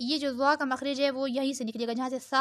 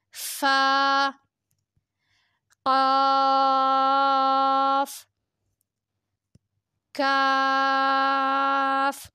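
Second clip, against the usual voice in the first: each letter name held long on one level tone; drawn-out letters; much slower than usual. Shown as a demonstration. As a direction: stretch each vowel evenly, five counts long. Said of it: "پانچ انگلیوں کے برابر کھینچیں گے".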